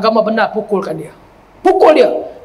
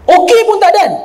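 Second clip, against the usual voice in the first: higher and louder, rushing out before the okay; mock hasty wife; 7 semitones higher, 4 dB louder than usual. Preacher mimics wife leaving before husband replies, rushing. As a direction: blurt it out, already out the door.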